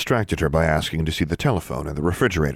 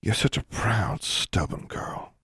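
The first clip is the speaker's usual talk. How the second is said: tender teasing; 4 semitones higher, 6 dB quieter, slower than usual. Acted. Julia comforting Alexandra.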